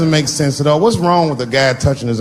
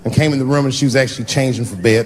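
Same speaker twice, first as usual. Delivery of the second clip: ordinary talk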